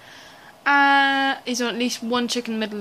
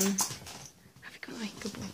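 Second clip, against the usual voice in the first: squeaky praising a dog; 8 dB quieter than usual. Real, not acted. Praising pet softly.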